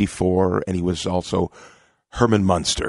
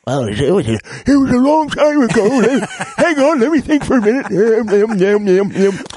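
silly high voice; 13 semitones higher, 7 dB louder than usual. Pretend. Straining to recall a name.

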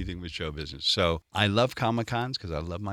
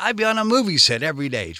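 eager retold answer; 8 semitones higher, 9 dB louder, faster than usual. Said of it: "I said".

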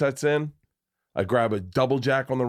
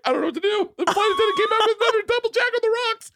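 very high comic squeal; mock panic; much faster than usual. Dad retelling his plane disaster, high mock panic. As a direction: tell it in a helpless high squeal.

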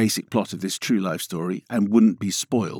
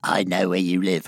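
heavy breathing phone whisper; 4 semitones lower than usual. Pretend. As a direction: menace it like a prank caller. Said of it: "round the back of your bins?".

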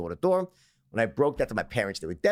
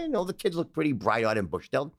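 ordinary talk, nothing marked

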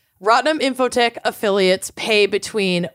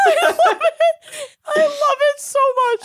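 high, tearful laughter; laughing crying; faster than usual. Real, not acted. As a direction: crack up till you cry.

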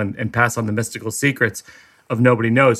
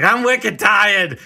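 put-on high-pitched Boston toddler; hammed-up Boston accent, pitched up high; 9 semitones higher than usual. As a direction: announce it like a cranky Boston tot. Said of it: "Boston toddlers are like".

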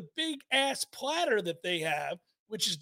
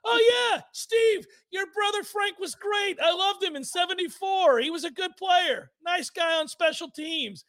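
much higher, mock enthusiastic recollection; imagined gushing reply; 8 semitones higher than usual. Coach imagined praising host's brother, high mock enthusiasm. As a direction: gush sarcastically in a high voice. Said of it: "was gonna go".

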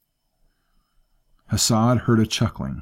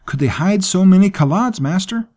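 frightened servant, much higher and louder; alarmed servant Safik; 12 semitones higher, 7 dB louder than usual. Acted. Servant tells his master the riders vanished, high and alarmed. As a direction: insist the riders vanished, rattled.

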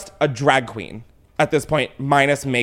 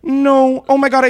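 shocked; 11 semitones higher, 8 dB louder than usual. Real, not acted. Shocked finding party photos.

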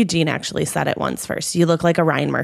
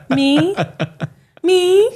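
surprised me; 10 semitones higher than usual. Real, not acted. Surprised repeat.

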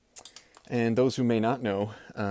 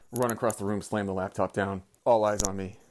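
ordinary talk

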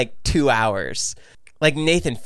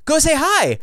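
high-pitched retold urging; lightly acted, eager prompt; imitated encouragement; 13 semitones higher, 3 dB louder than usual. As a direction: imitate the wife nudging you on. Wife urging him on, retold.

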